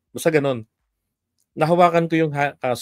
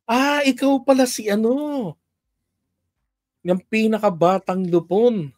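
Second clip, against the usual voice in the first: higher, slow, friendly official voice; mayor's greeting; 8 semitones higher, 3 dB louder than usual. Retold or imitated. Mayor surprised to meet the youngest lupon member, high slow greeting. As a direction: impersonate the mayor greeting her slowly, high. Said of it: "Ah, sabi niya".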